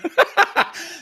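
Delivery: a short burst of high laughter. Real, not acted. Laughs at the joke.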